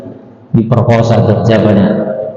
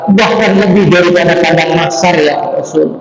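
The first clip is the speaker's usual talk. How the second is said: higher, anguished admission; despairing youth; 7 semitones higher than usual. Acted. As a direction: insist your sin is bigger. (pemuda putus asa, suara tinggi)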